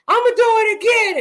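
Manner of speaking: high, loud mock yell of a five-year-old; feisty little boy. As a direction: imitate an angry child shouting a threat. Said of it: "feisty five-year-old boy".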